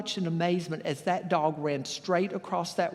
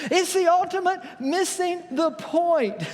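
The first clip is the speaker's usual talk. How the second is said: amused punchline; 11 semitones higher, 3 dB louder, slower than usual. Shown as a demonstration.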